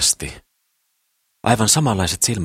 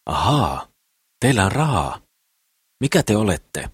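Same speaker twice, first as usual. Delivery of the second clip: higher-pitched exclamation of interest; exclaiming; 5 semitones higher than usual. A narrator's reading. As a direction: pipe up with sudden interest. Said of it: "Mimmi huudahti". (huudahtaen, korkeammalla äänellä)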